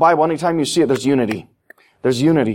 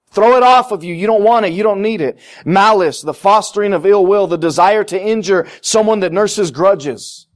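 fiery preaching; 5 semitones higher than usual. Acted. Pastor urging the congregation, preaching at full force. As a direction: preach it out hard.

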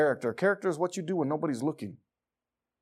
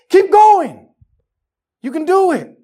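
high, full-force coach's yell; urgent sideline bellowing, acted; barking coach; 14 semitones higher, 14 dB louder, slower than usual. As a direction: shout a player back into action. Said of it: "we need a coach to grab us".

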